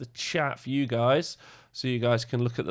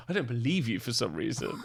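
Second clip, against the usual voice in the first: ordinary talk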